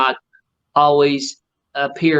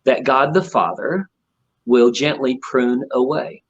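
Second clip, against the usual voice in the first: solemn scripture; slower than usual. Retold or imitated. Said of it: "And he said".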